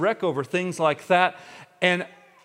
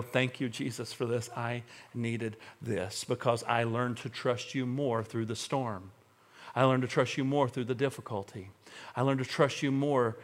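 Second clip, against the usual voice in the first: sincere thanks; 4 semitones lower, 7 dB quieter than usual. Shown as a demonstration. A believer thanking Jesus through the storm, quiet and sincere. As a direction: murmur gratitude from the heart. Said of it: "get to a place where he works in me".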